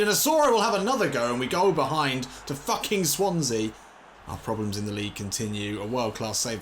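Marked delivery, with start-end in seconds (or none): 0.0-3.7 loud, high, fast angry rant; furious commentary. Real, not acted. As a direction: blurt it out as the goal goes in. Conceding a goal, furious.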